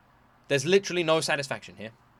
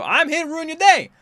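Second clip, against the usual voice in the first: high, louder mock quote of the host; imitating the video host; 13 semitones higher, 6 dB louder than usual. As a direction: toss off the host's threat in a lightly mocking voice. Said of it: "He literally said at the beginning".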